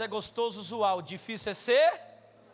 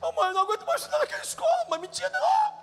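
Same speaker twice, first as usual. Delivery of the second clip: much higher, fast, wailing plea; whiny crying child; faster than usual. Retold or imitated. Boy comes home crying, begs mother to leave school, high wail. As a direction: beg like a sobbing little boy. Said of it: "eu chegava chorando".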